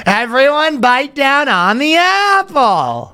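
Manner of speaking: high, slow class instruction; instructor voice. Pretend. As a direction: announce it to the class.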